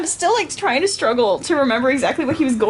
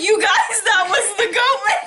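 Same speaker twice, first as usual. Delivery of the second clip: freaked out; 6 semitones higher than usual. Retold or imitated. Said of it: "freaked out".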